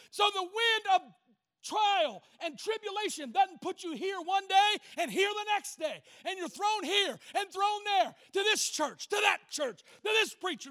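high, driving sermon cadence; impassioned preaching. Real, not acted. Preacher warning of being thrown church to church, impassioned preaching. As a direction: pound out each contrast in rhythmic phrases.

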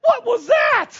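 high, loud laughing yelp. Retold or imitated. Friend Curtis calling back baffled, laughing shout. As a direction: blurt it through laughter.

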